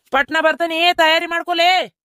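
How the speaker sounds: high-pitched call, last words drawn out. Acted. Leaving for the hospital, telling them to get ready, calling out. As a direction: call across to hurry the household.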